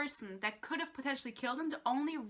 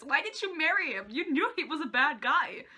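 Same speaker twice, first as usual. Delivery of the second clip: usual speaking voice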